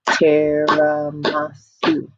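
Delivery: "Tiramisu" slowed to four level beats; split into syllables. Shown as a demonstration. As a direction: clap out "Tiramisu" as TI-RA-MI-SU. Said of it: "Let's do one more time".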